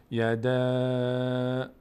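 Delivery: "یده" with the final vowel held very long and level; elongated madd. Shown as a demonstration. Teacher demonstrating how long madd lasts, "یده" greatly stretched. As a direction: sustain the thin final A of "یده" steadily.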